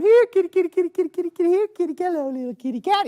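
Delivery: high coaxing here kitty call. Acted. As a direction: coax the strays in a high lilting call. Calling stray cats.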